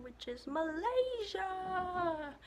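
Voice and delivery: a long, high, loving cry of the name; fond excitement. Real, not acted. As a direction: stretch "Malaysia" out high and slow with love. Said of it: "my favorite country in the world".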